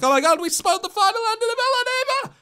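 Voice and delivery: high mock-panicked squeal; panicking fans. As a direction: mimic panicked fans shrieking. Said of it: "They're like".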